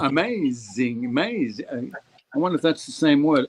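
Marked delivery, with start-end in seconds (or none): 0.0-1.6 AMAZING drawn out slowly, the MAZE syllable stretched; wordplay on amazing. Shown as a demonstration. Storyteller punning on the garden maze, drawn-out amazing.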